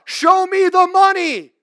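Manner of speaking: loud, high, slow mock chant of show me the money; mocking greedy preacher. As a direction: belt it out like a greedy televangelist. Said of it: "that's what they say".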